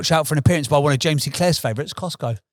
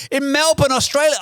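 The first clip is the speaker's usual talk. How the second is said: excited; 11 semitones higher than usual. Real, not acted. Excited about trip.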